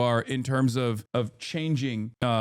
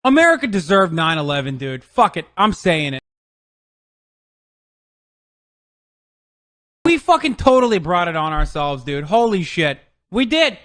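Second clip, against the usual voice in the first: loud, wild yelling of his own famous line; bellowed rant, louder and higher toward the end; mock shout; 5 semitones higher, 8 dB louder than usual. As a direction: yell it like a proud provocation. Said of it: "was saying".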